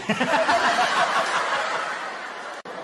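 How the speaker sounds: helpless laugh after the punchline; laughing at own joke. Real, not acted. Friend telling jokes, cracks up at his punchline.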